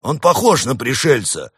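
loud, high objecting bear; stubborn bear. A narrator's reading. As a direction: insist at full voice as the bear. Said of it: "возразил упрямец".

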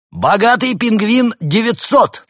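high, loud, slow triumphant call; booming prize announcer. Acted. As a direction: proclaim it to the hall. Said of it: "торжественно объяснил ведущий".

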